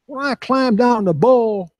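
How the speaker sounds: slow down and imitate Ty's way of talking; Ty Murray's voice. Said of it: "this is how he talks".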